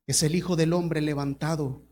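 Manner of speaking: ordinary talk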